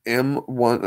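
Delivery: ordinary talk, nothing marked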